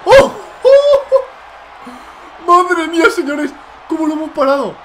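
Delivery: very high, loud yelp with a laugh; ecstatic shout. Real, not acted. Gamer kicking out at two, screaming with relief. Gamer survives a near pinfall.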